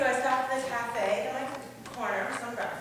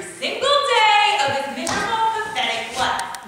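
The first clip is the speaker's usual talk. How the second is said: louder, higher mock-sung venom; bitter rant; 11 semitones higher, 10 dB louder than usual. Ranting about the waiter with sung venom. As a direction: spit out the insult with relish.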